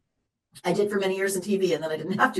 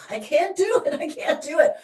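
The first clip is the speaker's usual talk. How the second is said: whiny can't; 8 semitones higher than usual. Retold or imitated. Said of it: "I would have been like".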